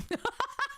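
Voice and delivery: real high-pitched giggle; amused laugh. Real, not acted. Streamer laughing at a viewer's dating comment, amused laugh. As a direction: burst into a short, high laugh.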